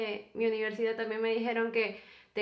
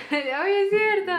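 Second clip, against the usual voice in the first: laughing through it, much higher and louder; laughing agreement; 8 semitones higher, 6 dB louder than usual. Real, not acted. Laughing at her boyfriend calling himself broke. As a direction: crack up while you agree.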